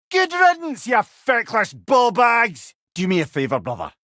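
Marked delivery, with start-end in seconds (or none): 0.1-2.7 high, loud mocking yell, slowing on the insult; jeering shout. Head jeering after a fallen berserker, loud mocking shout. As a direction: jeer them off, drawing out ballbag.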